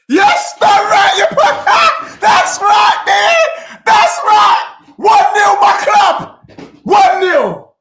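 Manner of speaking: screaming and laughing, high and loud as the goal goes in; real ecstatic yelling at a goal; goal celebration. Real, not acted. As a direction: yell with pure joy for your club.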